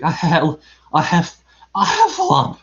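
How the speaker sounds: winded, stammering attempt; breathless Piglet. A narrator's reading. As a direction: struggle to get the word out between breaths. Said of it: "breathing so hard he could hardly speak".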